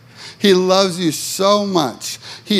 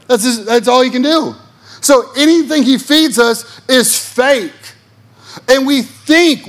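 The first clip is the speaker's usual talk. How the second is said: high, loud pulpit preaching; fired-up sermon voice; preaching at full force; 9 semitones higher, 4 dB louder than usual. Acted. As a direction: preach it hard to the congregation.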